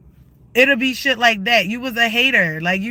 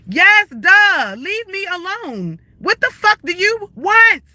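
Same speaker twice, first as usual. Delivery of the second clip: angry shouting; 8 semitones higher than usual. Real, not acted. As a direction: shout it, at the end of your rope. Shouting at harassers on stream.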